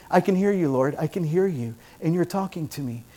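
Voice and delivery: soft, intimate acted prayer; hushed prayer reply. Preacher voicing a believer answering the Lord, hushed. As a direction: let it fade almost to nothing.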